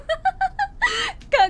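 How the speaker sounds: high squealing giggle; helpless laughing fit; burst of laughter. Real, not acted. Laughing at boyfriend calling himself cool, high giggle. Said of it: "そんなに笑うなよ".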